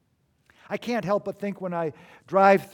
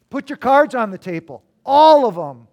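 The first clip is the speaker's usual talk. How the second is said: commanding call; 3 semitones higher, 10 dB louder than usual. Retold or imitated. Jesus voiced repeating the call.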